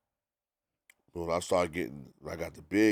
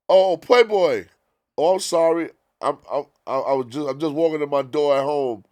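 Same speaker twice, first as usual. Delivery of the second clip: higher, louder, fake-sorry voice; mock apology; 7 semitones higher, 9 dB louder than usual. Puff mockingly apologizing to the DJ over the phone.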